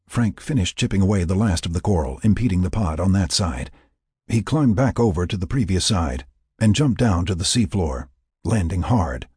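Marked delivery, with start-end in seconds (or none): none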